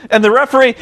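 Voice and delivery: ordinary talk, nothing marked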